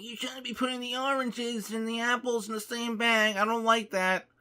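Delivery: put-on high, loud old-lady screech; shrill scolding granny, mocked; cranky old lady yelling. Old grocery shopper scolding the bagger, high loud mock screech. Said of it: "she was just yelling at me".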